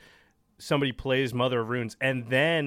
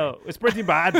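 louder self-mocking Scottish accent; 5 semitones higher, 6 dB louder than usual. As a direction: admit it in the same bad Scottish accent. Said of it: "not your accent".

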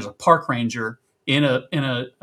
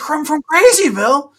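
high, loud, disbelieving shout; incredulous yell; 7 dB louder than usual. Retold or imitated. Ranger yells back across the river in disbelief. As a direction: shout it back like you can't believe it.

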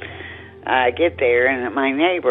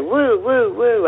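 whooping sound, sliding higher; imitated high whoop; 9 semitones higher, slower than usual. Caller mimics the strange night noise, a rising whoop. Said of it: "And it's high".

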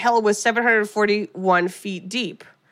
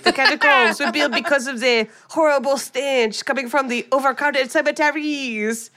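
higher mock French accent for the tour guide; 4 semitones higher than usual. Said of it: "that was my French accent".

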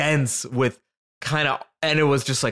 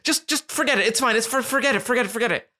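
much higher, hurried embarrassed brush-off; flustered cousin; 9 semitones higher, faster than usual. Retold or imitated. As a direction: wave it away in a fluster. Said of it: "Julia is flustered".

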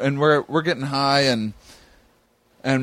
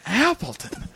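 incredulous; 9 semitones higher, 3 dB quieter than usual. Retold or imitated. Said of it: "I'm like".

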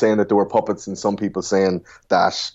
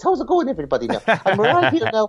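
high, jolly stage-Irish lilt; mimicking Michael D. Higgins greeting everybody in a high Irish voice; Irish president voice; 12 semitones higher than usual. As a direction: play up a super-duper Irish president greeting the crowd. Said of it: "And he talks like this".

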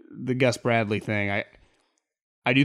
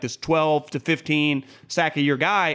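ordinary talk, nothing marked

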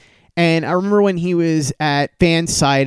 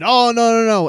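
high, rising horror; dismayed; 9 semitones higher, 4 dB louder than usual. Retold or imitated. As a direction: realize the mistake with mounting dismay. Friend realizing his mistake.

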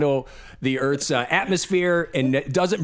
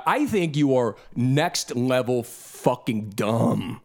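slow verdict, lower and weighty; slow, deliberate insult; 5 semitones lower, slower than usual. Real, not acted. Host telling the flat-earther off, slow and deliberate. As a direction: drag each word out slowly.

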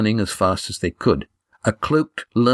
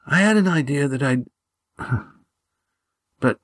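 higher, halting, strained confession; weak whispering; 5 semitones higher than usual. A narrator's reading. Dying Gar confiding in Lindy and Estrella, weakly whispered. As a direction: reminisce with effort, pausing between phrases. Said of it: "he whispered".

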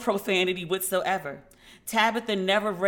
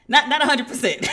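laughing out the words, louder; 5 semitones higher, 6 dB louder than usual. Real, not acted. She laughs while repeating her dad's verdict, louder. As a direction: crack up mid-sentence.

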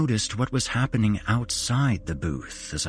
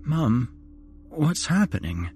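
whimpering fearfully; 7 semitones higher than usual. A narrator's reading. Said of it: "I whimpered fearfully".

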